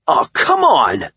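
high, drawn-out protest; exasperated cry. A narrator's reading. Shot hero falling to the ground, high exasperated cry. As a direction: cry out slowly against the unfairness. Hit by bullets as power ends.

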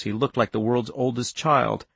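ordinary delivery

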